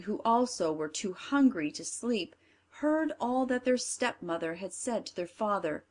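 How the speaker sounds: ordinary delivery, nothing marked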